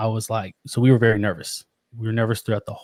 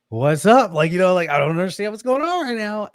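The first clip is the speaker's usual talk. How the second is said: louder, higher, bewildered demand; acted-out worried bewilderment; alarmed confusion; 7 semitones higher, 5 dB louder than usual. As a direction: press for an answer, raised and baffled. Repeats it, now alarmed at crying.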